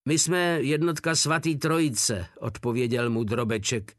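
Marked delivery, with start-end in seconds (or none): none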